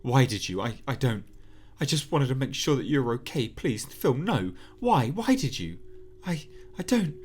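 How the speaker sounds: Sarah's voice haunting Phil, shrill frantic plea; frantic pleading. Acted.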